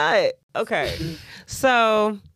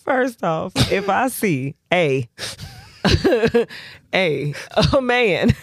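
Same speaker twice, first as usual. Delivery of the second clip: ordinary delivery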